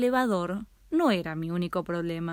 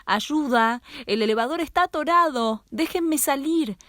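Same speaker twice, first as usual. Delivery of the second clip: higher, louder cries for help; frightened girl shouting for help; 6 semitones higher, 3 dB louder than usual. A narrator's reading. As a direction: call out through the stuck elevator doors. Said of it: "Comencé a gritar".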